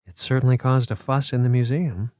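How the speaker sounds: usual speaking voice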